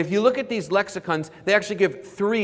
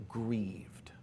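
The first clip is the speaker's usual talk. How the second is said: whispered; 6 semitones lower, 14 dB quieter than usual. Shown as a demonstration. Preacher hushes the word grieved to his congregation.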